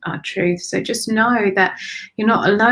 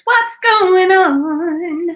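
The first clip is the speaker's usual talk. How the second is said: high, slow sing-song question; drawn-out question; 9 semitones higher, 5 dB louder, much slower than usual. Real, not acted. Sensing tension, drawn out.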